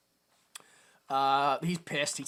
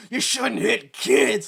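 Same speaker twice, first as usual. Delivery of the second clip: mock preachy; 6 semitones higher, 7 dB louder, much slower than usual. Mock lecture in film.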